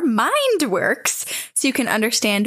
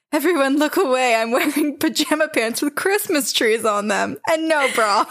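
laughing panic; 5 semitones higher than usual. Retold or imitated. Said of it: "I was like".

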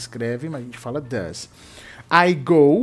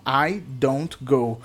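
very slow, each word set apart for students; slow teacher demo of a sentence; slow model sentence; much slower than usual. Shown as a demonstration. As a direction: spell it out slowly so the class can repeat. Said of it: "teacher models the sentence".

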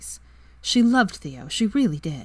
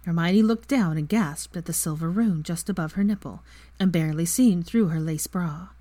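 ordinary delivery

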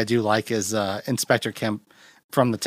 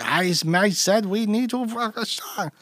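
put-on German accent; 10 semitones higher than usual. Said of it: "him being super German".

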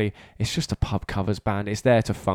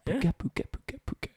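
mock drumming noise; 11 semitones higher, 11 dB quieter, faster than usual. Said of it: "doing all that".

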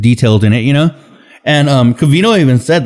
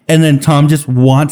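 ordinary delivery

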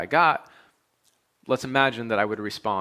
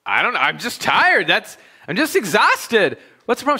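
defensive protest; 9 semitones higher, 5 dB louder than usual. Retold or imitated. Husband defends himself to wife.

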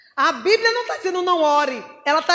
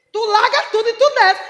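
loud, high preacher's order; forceful, high-pitched call to obey; urgent command; 7 semitones higher, 8 dB louder than usual. Acted. Acts the Spirit's urgent command.

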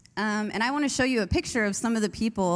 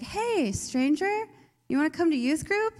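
cheery friend's invite; 8 semitones higher than usual. Retold or imitated. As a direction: sing out a friendly invite to a stranger. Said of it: "And she was like".

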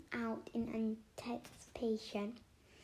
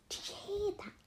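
high, breathless whisper; 11 semitones higher than usual. A narrator's reading. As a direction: whisper it in fright. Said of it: "she whispered".

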